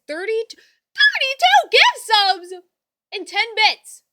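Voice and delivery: high, very loud, drawn-out shout; excited shouted announcement. Real, not acted. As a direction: belt out the total in amazement. Announcing hype train total.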